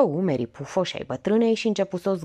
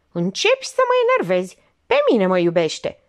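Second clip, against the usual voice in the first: annoyed; 2 semitones higher, 6 dB louder than usual. Acted. Olga losing patience with her aunt, sharp. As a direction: let her temper flare.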